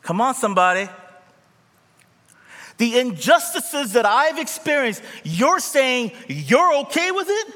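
high, loud, impassioned objection; indignant protest. Acted. Voicing the wronged protesting.

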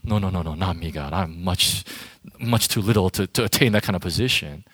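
low, mock-modest protest; false humility. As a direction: play coy and overly modest. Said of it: "You know what a prideful person would say?".

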